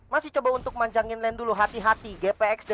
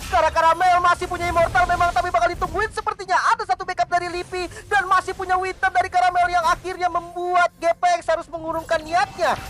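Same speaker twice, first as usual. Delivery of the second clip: excited; 8 semitones higher, 4 dB louder than usual. Real, not acted. Caster hyping a teamfight.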